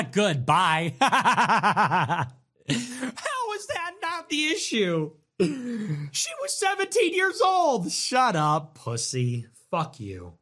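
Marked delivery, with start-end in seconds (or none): 1.0-7.9 high, slow sarcastic parody of the chatter; mocking whine. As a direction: stretch it out high like a pearl-clutching commenter. Mocking a chatter's outraged question.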